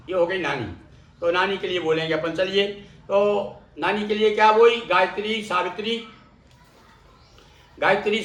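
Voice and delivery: ordinary delivery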